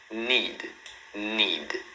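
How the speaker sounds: NEED ending on the D, no vowel after; clean final consonant. Shown as a demonstration. Teacher gives another example, correct pronunciation. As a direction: say "need" as one falling syllable. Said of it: "Olha outro exemplo".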